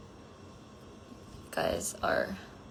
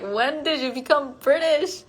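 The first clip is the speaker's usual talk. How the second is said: higher-pitched, incredulous question; mock astonishment; 6 semitones higher than usual. Streamer retelling her reaction to friends' new accents, mock astonished. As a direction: exclaim it in playful disbelief.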